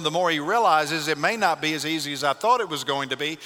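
ordinary talk

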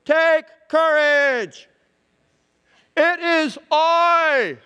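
loud, high, drawn-out call; big imitated shout to people far off; yelling out. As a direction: holler slowly across a windy lake. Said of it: "and he yells out".

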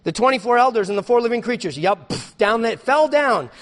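loud, high pulpit voice; driving, proclaiming delivery; forceful scripture reading. Real, not acted. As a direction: read it out with urgency. Reads scripture at full force.

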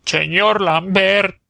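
high, long-drawn cry with Auvergnat "ch"; stretched, high-pitched exclamation; exclaiming, Auvergnat accent. A narrator's reading. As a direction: call out in a high Auvergnat wail. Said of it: "exclamó Romagné".

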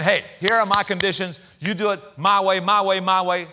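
loud, insistent demands; demanding. Pretend. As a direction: bark out your conditions. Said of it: "They're not saying".

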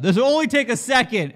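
a loud, high, cheerful yell to the whole bank; yelled announcement. Retold or imitated. A man shouting to everyone in the bank, loud and high. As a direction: call it out across the room, loud and high. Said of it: "he yells out".

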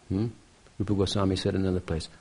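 normal talking voice

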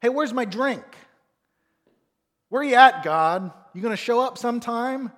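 loud, high, bratty demanding voice; entitled child shouting impatiently. Retold or imitated. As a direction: yell at God like a spoiled kid. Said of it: "that sense of entitlement".

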